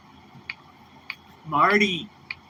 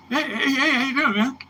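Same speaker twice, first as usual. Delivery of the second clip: much higher, louder, eager hello; starstruck greeting; 14 semitones higher, 6 dB louder than usual. Retold or imitated. A fan greets his guitar hero, in a high, loud, starstruck voice.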